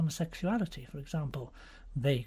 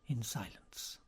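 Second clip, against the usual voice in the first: ordinary delivery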